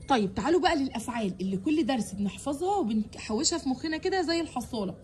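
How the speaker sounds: usual speaking voice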